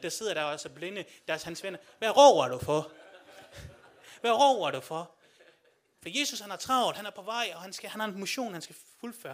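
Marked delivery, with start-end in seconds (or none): 2.0-5.2 louder, irritated yelling at a friend; annoyed friends shouting back; fed-up shout. Retold or imitated. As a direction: tell him off loudly to shut up.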